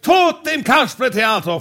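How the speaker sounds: mock battle cry; theatrical shout. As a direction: cry it out like a puppet opening the show.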